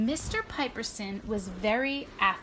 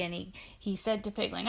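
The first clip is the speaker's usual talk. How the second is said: usual speaking voice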